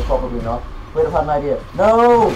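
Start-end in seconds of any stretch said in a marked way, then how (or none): 1.8-2.3 louder, higher gamer yell; a real yelp of protest; dismayed shout. Real, not acted. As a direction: cry out in dismay. Game goes wrong.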